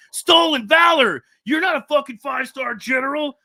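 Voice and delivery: loud angry yell, way up high; retold screaming. Two guys screaming at him at a gas station, furious yell. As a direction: scream it at him like an enraged stranger. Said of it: "they're, like, screaming at him".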